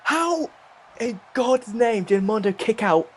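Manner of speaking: high, slow stunned disbelief. Real, not acted. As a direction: ask it in total shock. Shocked at the kickout.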